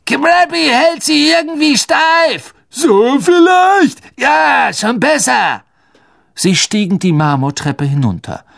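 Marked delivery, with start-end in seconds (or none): 0.1-5.6 high, loud, drawn-out character voice; disguised boy's voice. Acted. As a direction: pitch it way up and push it loud. Ron disguised as Crabbe, replying.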